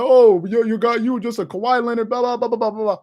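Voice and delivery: run-on mock complaint; mocking critics. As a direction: mimic chattering naysayers, trailing into blah-blah. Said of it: "People were like".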